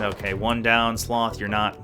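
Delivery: usual speaking voice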